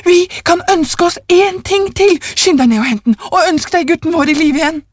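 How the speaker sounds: high, feverish exultation; triumphant. A narrator's reading. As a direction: urge him on triumphantly, pitch high. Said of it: "ropte hun triumferende".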